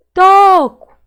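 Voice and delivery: "Toco" with a very loud, high, long TO; stretched stressed syllable. Shown as a demonstration. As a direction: call out "Toco", holding TO. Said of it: "Qual que eu demorei mais falar".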